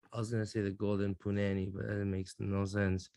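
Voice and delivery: low, quiet throwaway aside; muttered aside. Real, not acted. Joking aside to the room.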